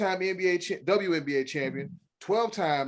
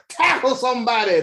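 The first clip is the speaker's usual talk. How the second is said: loud, raised-pitch exhortation; exasperated coach's order; 7 semitones higher, 7 dB louder than usual. Acted. Scolds soft players, barking orders.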